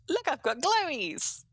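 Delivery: light up at the find, voice jumping high; delighted. Real, not acted. Streamer spots glowies in the mine, excited squeal.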